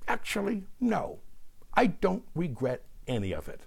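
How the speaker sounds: high, short mock-considered reply; Roseanne Barr. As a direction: drop the volume of the rant, answer high after a pause. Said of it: "Roseanne replied".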